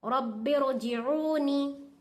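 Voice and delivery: "रब्बि रजिऊनी" drawn out slowly, ra full and heavy; slow recitation. Shown as a demonstration. Teacher reciting slowly to show the heavy ra. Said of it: "पुर पढ़ना".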